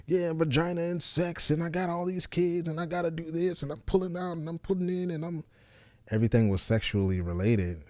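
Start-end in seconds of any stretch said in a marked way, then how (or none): 0.0-5.4 higher, fading softer, rambling crude mock; mocking old self. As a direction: send up your old crude jokes, trailing off. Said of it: "a lot of it's like".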